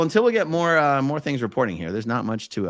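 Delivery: usual speaking voice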